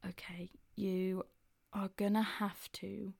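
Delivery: hushed, slow whisper. Retold or imitated. Dad quietly telling his son the grim fix, whispered. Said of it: "his dad was like".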